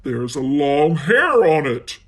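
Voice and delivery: loud gruff bear voice; Daddy Bear notices a hair, loud and gruff. Acted. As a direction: bark it as the big bear.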